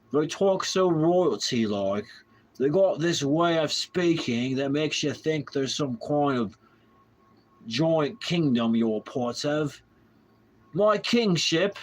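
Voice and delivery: put-on slow, high, royal British accent; hammed-up regal British voice; fake British accent. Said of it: "you sound like a true Brit".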